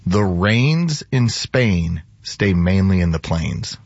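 slow, carefully enunciated "The rains in Spain"; slow elocution demo. Shown as a demonstration. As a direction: model proper diction, very deliberately. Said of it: "I want you to say".

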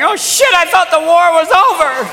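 very high, hollered dismay; mock grandmother in high-pitched alarm. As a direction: shriek it like a panicked granny. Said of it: "when your grandmother's going".

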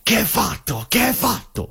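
high, exclaimed Italian outburst; hammed-up angry Italian man; mock Italian yelling. Italian man yelling at the mate over the bin. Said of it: "starts yelling at him".

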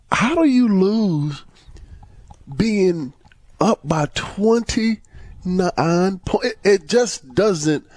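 high, drawn-out disbelief; slow, high-pitched bafflement at a collapse; incredulous. Real, not acted. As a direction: stretch the words out high, stunned. Stunned at blown lead.

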